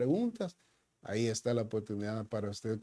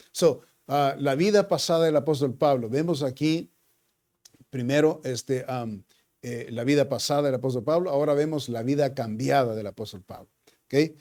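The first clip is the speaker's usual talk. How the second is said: louder, driving sermon delivery; fervent preaching; 5 dB louder than usual. Acted. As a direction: preach it out to the congregation. Preaching at rising force.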